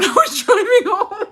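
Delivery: laughing through the words. Real, not acted. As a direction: crack up mid-sentence. Laughing at her story.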